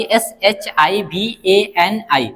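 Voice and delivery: S H I V A N I spelled out slowly, letter by letter; slow spelling. Shown as a demonstration. Teacher spelling out a name slowly for students. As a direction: dictate each letter slowly and clearly. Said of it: "इसकी जो right जो spelling होगी वो क्या होगी".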